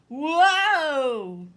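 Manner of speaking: loud, surprised cry; startled whoa. Acted. Big puppy stumbles while learning to tiptoe, loud whoa. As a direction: yelp as if losing your balance.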